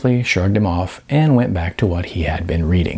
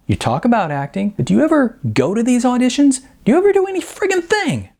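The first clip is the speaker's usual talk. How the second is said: rising higher and faster to the end; Sean exasperated; 11 semitones higher than usual. A narrator's reading. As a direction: push harder until it cracks high.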